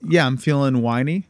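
ordinary delivery

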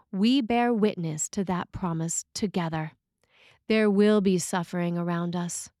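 normal talking voice

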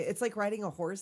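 usual speaking voice

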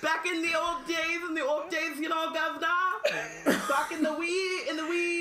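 high, sing-song fake Cockney; badly faked Cockney sliding toward Jamaican; sort-of Cockney. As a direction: ham up a terrible old London accent. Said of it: "It's sort of Cockney".

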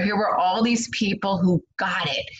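normal talking voice

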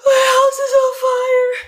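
very high, loud crying wail; hysterical wail. Retold or imitated. Telling Lacey the house burns, re-enacted hysterical wail. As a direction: re-enact a panicked, tearful cry down the phone. Retells frantic call to friend.

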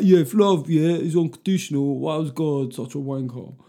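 a hint of a pompous RE pupil asking a clever question; GCSE RE geezer. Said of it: "I sound like the geezer from G C S E R E".

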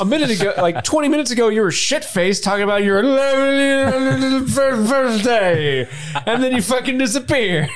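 high, laughing mock scold; high-pitched laughing hobbit rant; Frodo scolding. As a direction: scold Bilbo in a squeaky voice.

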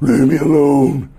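loud, slow demonic outburst; voice from hell. Acted. Provoked partner snapping at their tormentor, voice from hell. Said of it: "That voice from hell come out of you".

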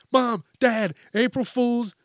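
put-on spring the joke like a pleased kid; cheeky kid reveal. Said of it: "And they're like".

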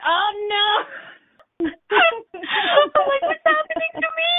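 high, laughing mock whine; laughing mock despair. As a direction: wail it through giggles.

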